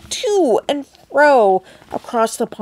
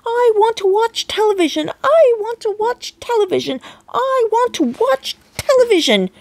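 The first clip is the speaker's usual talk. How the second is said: much higher whiny squeal; tantrum squeak; 12 semitones higher than usual. A narrator's reading. Tiny boy throwing a tantrum at his father, squeaky whine. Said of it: "he squeaked".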